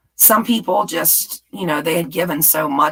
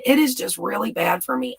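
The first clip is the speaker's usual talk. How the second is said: usual speaking voice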